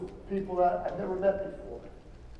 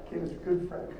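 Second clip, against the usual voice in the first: usual speaking voice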